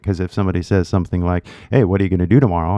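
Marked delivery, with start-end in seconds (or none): 1.7-2.8 very fast, words run together as "whadaya gonna do tomorrow"; fast casual reduction. Shown as a demonstration. Someone casually asks a learner about tomorrow, fast and slurred together. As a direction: rattle off WHAT ARE YOU GONNA DO TOMORROW as one blur. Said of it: "not a lot of teachers that teach".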